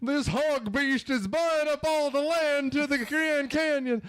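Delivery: high, drawn-out hammy movie villain; Devil's Advocate parody. Retold or imitated. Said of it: "have you seen Devil's Advocate?".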